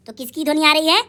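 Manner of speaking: ordinary talk, nothing marked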